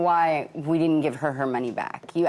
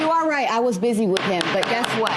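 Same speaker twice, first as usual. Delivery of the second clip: shouting; 5 semitones higher than usual. Real, not acted. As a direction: shout it back, heated. Heated courtroom argument, shouting back.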